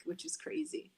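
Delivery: whispered aside; hushed whispered comment. Real, not acted. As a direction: breathe it out as a secret. Aside about her crazy schedule.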